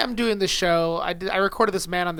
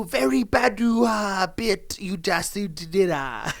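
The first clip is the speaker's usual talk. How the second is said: slow, hammed-up Japanese accent; fake Japanese accent; much slower than usual. Comic reviewing the bit, bad Japanese accent. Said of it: "No, he's Japanese".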